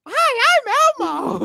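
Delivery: very high Muppet voice; Elmo. Retold or imitated. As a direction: squeak it out like a puppet. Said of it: "sounds like she's like".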